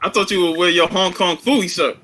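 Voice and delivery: normal talking voice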